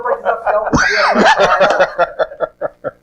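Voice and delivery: laughing through the line; half-laughing, slow. Real, not acted. Laughing at the joke.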